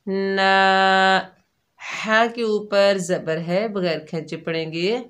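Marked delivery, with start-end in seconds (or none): 0.1-1.3 ना held one alif long; ना lengthened; stretched na. Shown as a demonstration. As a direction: draw out ना in recitation. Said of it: "एक अलिफ की मिकदार खींच कर पढ़ेंगे".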